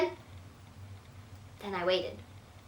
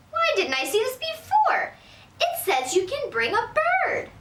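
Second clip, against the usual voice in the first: higher, slow dawning delight; delighted grandma; 13 semitones higher, 4 dB louder, slower than usual. Acted. Grandma spotting the rule about birds, slow and delighted. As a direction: let the idea dawn slowly and happily.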